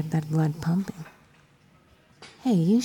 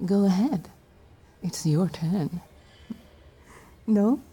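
normal talking voice

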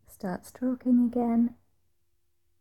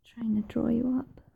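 intimate whisper; 4 semitones higher than usual. Acted. Whispering intimately to her partner, sultry whisper. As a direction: breathe the words close to the mic.

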